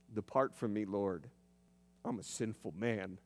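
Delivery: reverential fear. Retold or imitated. Peter on the deck before Jesus, hushed and awed. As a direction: drop your voice low in awed fear. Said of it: "And in fear, reverential fear".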